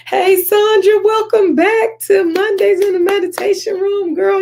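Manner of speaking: thrilled high-pitched hello to a returning sister; excited greeting. Real, not acted. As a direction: call out the hello like spotting a friend. Host greeting returning viewer.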